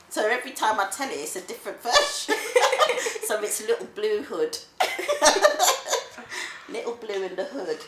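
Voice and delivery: loud, breaking into laughter between phrases; amused, laughing. Real, not acted. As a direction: tell it through big laughs. Grandma jokes about her made-up story.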